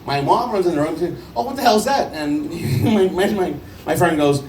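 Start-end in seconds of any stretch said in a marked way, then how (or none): 1.4-2.1 shocked mom. Retold or imitated.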